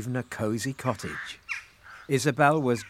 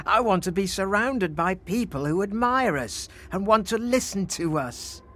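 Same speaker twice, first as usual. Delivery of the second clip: higher-pitched, wanting moan; 7 semitones higher than usual. A narrator's reading. Emperor wanting admirers, a higher-pitched moan. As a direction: whine for admiration. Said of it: "moaned Frederick".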